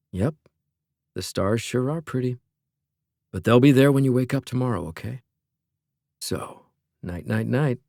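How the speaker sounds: soft, quiet bedtime voice; gentle soothing. A narrator's reading. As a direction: soothe her to sleep gently. Brother soothing sister to sleep.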